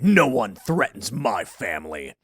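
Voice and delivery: low, slow action-hero voice; macho hero. Retold or imitated. Hero after killing the bear, low and slow. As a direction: deliver it like a gruff movie protector. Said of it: "And then he's like".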